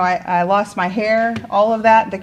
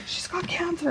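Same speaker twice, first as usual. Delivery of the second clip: whispered aside; 11 semitones higher, 9 dB quieter than usual. Retold or imitated. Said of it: "he's like".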